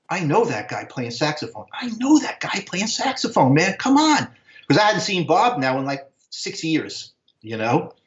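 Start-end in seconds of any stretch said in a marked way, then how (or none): 1.7-4.3 high, incredulous re-enacted exclamation; freaking out. Spotting an old friend on stage, freaking out. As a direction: insist on it in amazed disbelief, pitch leaping. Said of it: "I was freaking out".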